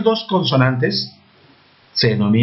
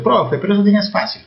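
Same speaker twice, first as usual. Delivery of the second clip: quick, slightly higher voice of a pupil objecting; cheeky student; 5 semitones higher, faster than usual. Pretend. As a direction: play the know-it-all pupil, lightly.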